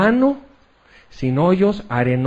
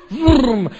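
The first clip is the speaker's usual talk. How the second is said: horse engine noise; 6 semitones higher, 3 dB louder than usual. Retold or imitated. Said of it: "él decía".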